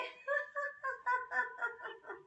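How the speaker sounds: apologising laugh in laughter yoga; laughter exercise laugh. Shown as a demonstration. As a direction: let a light laugh fall away. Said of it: "exercise कर लेते हैं हंसते हुए".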